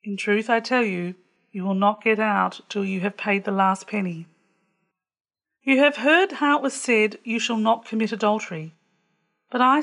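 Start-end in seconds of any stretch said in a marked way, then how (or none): none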